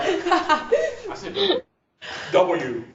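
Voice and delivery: high laughing W's, as if students mutter it to themselves; mock student muttering. Teacher mimicking students muttering the letter, laughing. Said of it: "people saying it right now to themselves".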